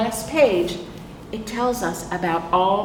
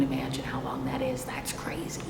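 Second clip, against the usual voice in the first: whisper; 6 semitones lower, 10 dB quieter than usual. Real, not acted. Awed aside to children.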